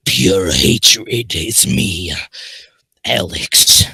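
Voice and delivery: deep, slow, rasped-out character voice. Acted. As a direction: drop low and drag the words, loudly. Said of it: "lower my voice a couple octaves".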